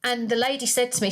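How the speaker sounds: ordinary talk, nothing marked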